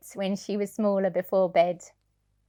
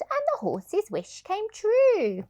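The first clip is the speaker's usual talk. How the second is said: much higher and slow, floating up; magical sing-song; 12 semitones higher than usual. Acted. Reader to child, sing-song magic moment. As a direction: let the magic rise.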